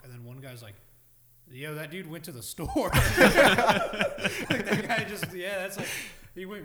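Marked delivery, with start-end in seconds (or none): 1.5-5.9 laughing. Retold or imitated. Quoting a neighbor's reply.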